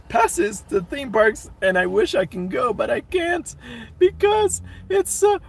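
mock whining. Streamer laments unused theme park passes, mock whining.

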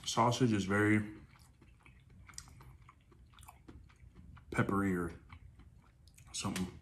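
talking while chewing food; muffled words around food; mouth full, chewing. Real, not acted. Seen 2: Bites sausage at -5, then jaw and lips keep working while talking.

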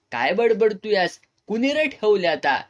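indignant retort. A narrator's reading. Village head answers accusation about a woman, indignant and loud. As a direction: snap back loudly in indignation. Acted angry retort in story.

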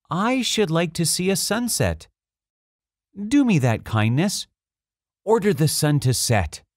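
high-pitched little prince asking a favor; little prince, pleading. A narrator's reading. Little prince asking the king for a sunset, high-voiced request.

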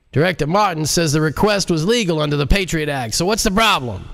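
a hammed-up blustering president; impatient president. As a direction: demand an answer, impatient and in charge. President character voice.

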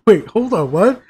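laughing loud and high; laughing disbelief. Real, not acted. Reacting to the plot reveal, laughing in disbelief. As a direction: burst out in amused shock.

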